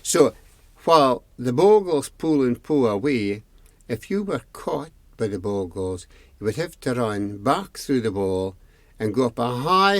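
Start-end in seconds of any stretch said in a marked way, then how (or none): none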